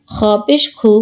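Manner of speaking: no stretch on খু; short vowel. Shown as a demonstration. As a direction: end on a short খু. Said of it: "কোথায় দ্রুত পড়ি".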